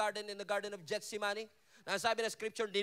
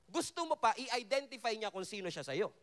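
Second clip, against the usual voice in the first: ordinary delivery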